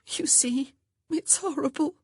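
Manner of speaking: high, breathy whimper near tears. A narrator's reading. Grace confessing to her sister, whimpering. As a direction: plead it through tears, half whispered. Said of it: "Grace whimpered".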